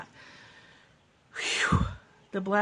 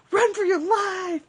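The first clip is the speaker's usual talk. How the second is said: high, loud hammed-up cry of alarm; mock panic; 13 semitones higher, 9 dB louder than usual. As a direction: shout it like fleeing a monster. Jokes about the cat war.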